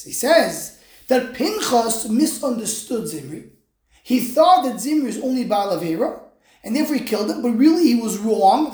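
ordinary talk, nothing marked

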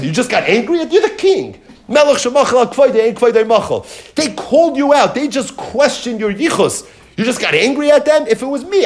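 high, incredulous egging-on, half laughing; goading mockery. Bad guy eggs on the angry king, high scoffing goad. Elazar goads the king to revenge.

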